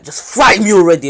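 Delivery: shouting at his rival to fight; angry shout. Retold or imitated. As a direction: yell it in frustration. Sasuke losing patience, demanding fight.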